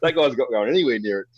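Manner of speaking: high, appalled inner voice; laughing disgust. Retold or imitated. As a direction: recoil in mock horror. Recalled thought, disgusted.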